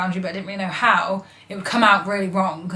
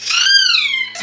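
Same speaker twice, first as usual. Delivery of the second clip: high shrieking laugh imitating pain; imitating partner's overreaction; mock pained shriek; 6 dB louder than usual. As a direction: squeal as if hurt. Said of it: "she'll be like".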